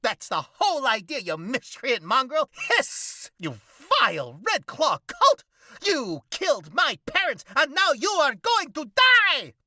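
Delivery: high, loud, mock-heroic vigilante voice; hammed-up cockroach hero. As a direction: declaim like a pompous masked avenger. Cockroach threatens victim.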